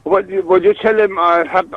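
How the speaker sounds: usual speaking voice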